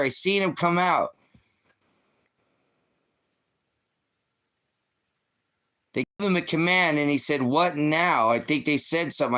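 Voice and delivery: loud, high-pitched agitated insistence; loud and worked up, pitched well up; upset outburst. Real, not acted. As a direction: raise your voice in real distress. Distraught reaction to replayed clip.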